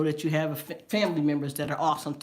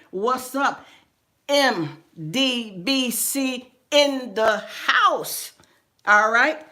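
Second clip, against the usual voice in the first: loud, high greetings to the chat; excited shout-outs; 4 semitones higher, 5 dB louder, slower than usual. Real, not acted. Host greeting viewers by name in chat, loud and high. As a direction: call out each name with joy.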